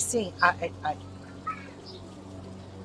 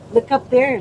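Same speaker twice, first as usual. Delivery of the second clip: loud coaxing; 10 dB louder than usual. Real, not acted. Coaxing toddler to look at camera.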